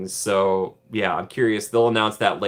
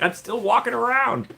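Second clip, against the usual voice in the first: much higher, a playful Sonny voice; lightly voiced Sonny; 11 semitones higher than usual. Vlogger imagining Sonny still at the park, higher playful voice. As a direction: play Sonny busy at the park. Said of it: "he's like".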